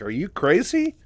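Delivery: high, loud incredulous question, climbing at the end; hammed-up shocked question; reeling disbelief. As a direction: imitate someone utterly incredulous. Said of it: "still reeling from all the info before".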